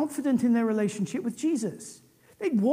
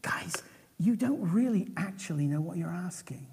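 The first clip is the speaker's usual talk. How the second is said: hushed, softer near-whisper; gentle response; 3 semitones lower, 8 dB quieter than usual. Retold or imitated. Jesus answering his disciples' request, gently. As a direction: let them down gently and quietly. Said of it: "he responds so gently".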